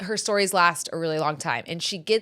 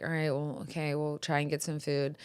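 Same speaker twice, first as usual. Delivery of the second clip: mild, quiet reassurance; 4 semitones lower, 9 dB quieter than usual. Retold or imitated. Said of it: "I'm like".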